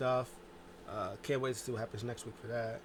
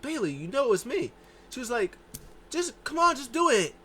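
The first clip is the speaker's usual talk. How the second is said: much higher, louder exasperated urging; 11 semitones higher, 7 dB louder than usual. Retold or imitated. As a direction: push her to get on with it. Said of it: "She's like".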